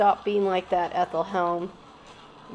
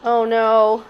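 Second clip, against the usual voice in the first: urgent alarm; 5 semitones higher, 10 dB louder, much slower than usual. Real, not acted. Urging character to flee.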